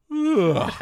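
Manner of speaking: sudden loud, high-pitched groan; hammed-up groan, bursting out; mock groan. Hero sneaking up on the house, sudden exaggerated groan. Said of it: "he's like".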